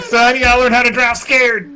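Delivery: hammed-up fatherly shout; shouted movie quote. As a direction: holler it like a movie dad. Acting out the movie dad's line.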